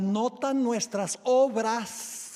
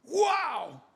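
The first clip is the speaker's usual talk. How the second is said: high, drawn-out wow; acted amazement; 7 semitones higher than usual. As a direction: play the debtor overjoyed and astounded. Acted debtor amazed at pardon.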